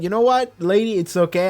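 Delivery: a customer rushing to escape; flustered exit. Retold or imitated. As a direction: bail out of the room, voice up. Imagining fleeing awkward shop scene.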